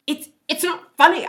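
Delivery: loud, high, flustered protest; embarrassed protest. Retold or imitated. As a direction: cry out in mock outrage at a laughing husband. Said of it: "And I'm like".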